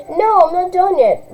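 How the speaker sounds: put-on high, sing-song kid voice; teasing little girl. Little sister teasing through the door, high and childish. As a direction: tease your big sister.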